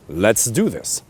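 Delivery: firm, falling, settled invitation; decisive statement. Shown as a demonstration. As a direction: say it as a decision, not a question. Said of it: "Even just the way you say it".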